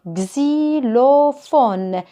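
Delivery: xylophone slowly drawn out, "gzi-lo-fone"; syllable by syllable. Shown as a demonstration. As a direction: stretch each syllable of xylophone, X as gz. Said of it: "L'association de ces quatre syllabes donne". (« xylophone » lentement, syllabe par syllabe, gzi-lo-fone)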